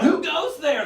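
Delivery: high, prim "Who goes there?"; a high, fussy old-timey challenge; prissy challenge. Retold or imitated. Waking to a burglar, a high prim call. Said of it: "all it took was one".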